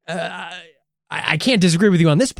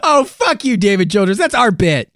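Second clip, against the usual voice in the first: mock outrage; 5 semitones higher, 5 dB louder than usual. Mock outrage at stolen bit.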